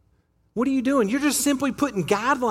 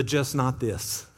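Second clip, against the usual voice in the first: slow "just not this", lower and softer, weight on "just"; slow stressed repeat; 8 semitones lower, 5 dB quieter, slower than usual. Retold or imitated. Preacher repeating God's limit, slow and hushed. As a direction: lean on "just", drawn out and quiet.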